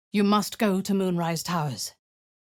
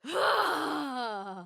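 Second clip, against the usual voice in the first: sharp startled gasp; 10 semitones higher, 4 dB quieter than usual. Acted. Sudden shock.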